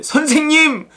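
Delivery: acted cry to rouse someone; urgent waking cry. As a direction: call out as if waking the teacher. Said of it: "제자들이 깨우며 이르되".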